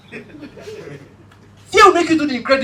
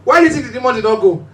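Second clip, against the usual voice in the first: ordinary delivery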